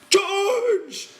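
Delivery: high, loud frightened cry; a big man crying out in panic; terrified cry. A narrator's reading. Lenny cries to George for help, terrified. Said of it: "he cried".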